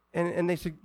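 ordinary delivery

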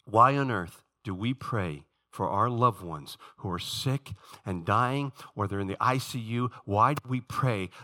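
normal talking voice

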